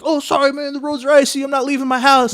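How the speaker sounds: high, lightly put-on excuse voice; mocking the friends. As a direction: toss off the excuse like a lazy friend. Friends voiced refusing to help.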